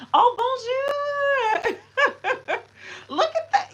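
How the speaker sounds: very high, loud shriek into laughter; delighted shriek. Real, not acted. Streamer shrieking at a big gift, laughing. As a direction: shriek with joy, then laugh.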